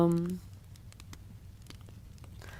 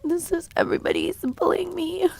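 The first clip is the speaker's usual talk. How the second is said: high-pitched whine; 11 semitones higher than usual. Pretend. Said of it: "I just get high-pitched".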